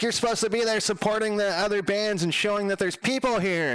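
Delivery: high-pitched imitation of an angry scolding; retold telling-off, voice pushed way up; livid. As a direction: mimic a livid venue manager, high and insistent. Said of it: "that chick was livid".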